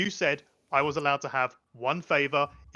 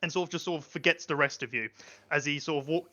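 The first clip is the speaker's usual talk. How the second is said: ordinary talk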